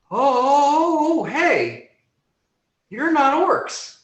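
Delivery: sudden high surprised squeak. Acted. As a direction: menace collapses into surprise. Dragon drops menace, surprised.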